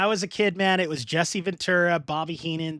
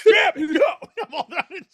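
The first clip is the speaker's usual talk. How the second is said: much higher, louder mock outburst; mock frustration; 11 semitones higher, 7 dB louder than usual. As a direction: throw a hammed-up tantrum. Acting frustrated commentary retake.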